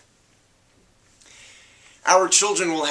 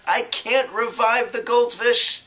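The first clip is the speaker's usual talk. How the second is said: higher, slow, impatient father; dismissive dad; 9 semitones higher, slower than usual. Acted. Father to grieving daughter, slow and impatient. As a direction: spell out the obvious to the child. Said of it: "Dad says".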